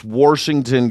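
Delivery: "Washington" with an R, like "Warshington"; Nebraska pronunciation. Retold or imitated. Comedian mocking dad's home state, Nebraska pronunciation. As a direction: put a hard R into the first syllable of "Washington". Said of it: "That's how they say Washington in Nebraska".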